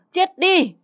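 very high, loud shriek of fury; crazed raging scream. A narrator's reading. Mother beating the girl, crazed scream. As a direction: scream the curse at the top of the voice. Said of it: "Bà Tư như điên loạn".

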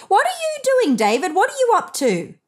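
high-pitched calling voice, questioning. A narrator's reading. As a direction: parents calling up to the bedroom. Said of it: "heard him and called".

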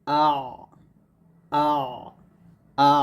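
আ sounded cleanly and repeated as a model; demonstrated vowel. Shown as a demonstration. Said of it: "এরকমভাবে".

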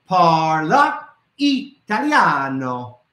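"Parla Italiano" very slow, stretching "Italiano"; host saying it together with the kids; slow repeat-after-me. Shown as a demonstration. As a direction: lead the kids through "Parla Italiano" slowly. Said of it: "Say it with me".

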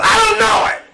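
high shouted denial, flinging it off. Acted. Peter shouting his denial at the lady. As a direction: yell it like a cornered man denying everything.

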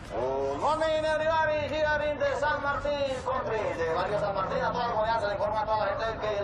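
megaphone announcement. Acted. Loudspeaker announcement.

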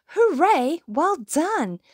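high, lilting congratulations; cheering. A narrator's reading. Mouse praising the band, high happy cheering. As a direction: cheer your bandmates on brightly.

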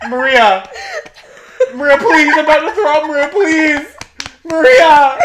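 high, loud pleading that builds to a shout; yelling. Retold or imitated. Re-enacting waking a friend back to back, desperate yelling. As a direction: escalate from a loud call to a full yell. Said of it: "I was yelling".

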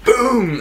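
high sharp imitated boom; door boom. Door bursting open, imitated boom. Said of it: "heard the door go".

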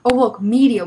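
ordinary delivery

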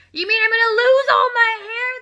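high, loud panicked husband; horrified yell. Acted. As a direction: yell it in disbelief. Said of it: "the husband yelled".

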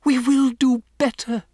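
high, eager-to-appease response; anxious audience reply. A narrator's reading. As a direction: answer as cowed witches promising obedience. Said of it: "murmured the audience".

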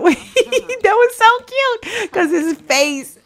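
high, slow, laughing gush; delighted laugh. Real, not acted. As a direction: gush with a laugh at something cute. Delighted by cute animation.

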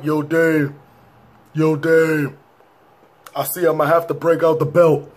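lightly imitated rap opening. Vlogger mocking the track's intro, imitated rapper voice. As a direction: mimic a spoken intro on an old car tape. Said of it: "It sound like you sitting in your car".

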